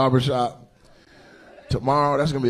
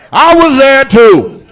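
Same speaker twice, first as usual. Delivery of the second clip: slow loud catchphrase; 10 semitones higher, 10 dB louder, much slower than usual. Acted.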